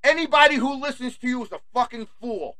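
scornful jab said up high. Shown as a demonstration. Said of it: "repeats line differently".

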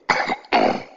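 short, breathy fake cough; mock cough. Shown as a demonstration. Coughing at the boss to show how sick he felt, a mock cough. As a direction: fake a little cough at him. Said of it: "I probably said".

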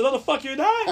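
high, loud comic protest; mock outraged refusal. Patient refusing the nurse's help, mock outraged yelp.

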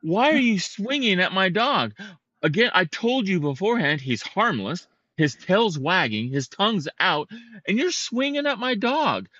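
indignant, high-pitched scolding; retold fury. Dog owner confronting the man with the stick, retold fury. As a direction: re-enact an angry telling-off, pitch jumping up. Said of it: "and I'm furious".

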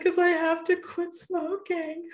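high, slow words through real tears; crying retold. To the doctor, crying about having to quit smoking. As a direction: sob it out while confessing. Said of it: "I cried like a baby".

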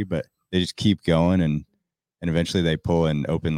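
normal talking voice

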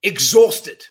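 exhausted said loud with a rise; emphatic exhausted. Real, not acted. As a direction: lean hard on exhausted. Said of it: "I'm feeling".

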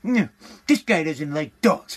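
snobby mock voice. Pet store customers sneering at him, a slow snooty mock voice. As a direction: drawl it like a snooty pet owner. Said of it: "looking at you like".

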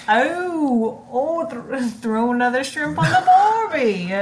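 hammed-up Aussie accent, laughing; a bad Australian impression; mock Australian accent. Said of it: "she did the New Zealand accent".

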